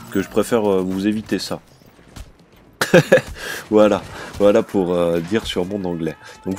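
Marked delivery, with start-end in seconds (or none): 2.8-3.7 embarrassed laugh. Real, not acted. Laughs about his bad English accent.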